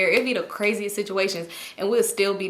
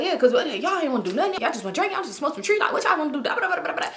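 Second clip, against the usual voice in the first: high, rushed imitation collapsing into babble; talking too fast; 5 semitones higher, faster than usual. Homeboy questioning what they wanna do, too-fast mock rambling. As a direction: rattle it off like his motormouth friend. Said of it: "He was just talking too fast".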